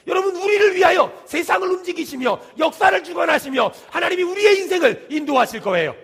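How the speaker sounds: high, loud sermon rising in force; full-force preaching. Real, not acted. Pastor assuring the congregation God works for them, emphatic preaching.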